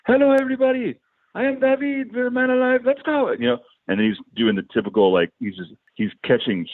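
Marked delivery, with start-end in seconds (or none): 0.1-3.3 very high, hammed-up foreign accent; Borat-like heavy accent. Guitarist greeting the crowd on stage, in a heavy Borat-like accent. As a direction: imitate a thickly accented frontman hyping the crowd. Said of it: "that is how he speaks to the crazy".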